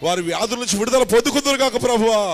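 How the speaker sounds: from steady to loud, high pleading; building healing prayer. Acted. Pastor praying for the sick, rising to a loud cry. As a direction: swell into a fervent plea for healing.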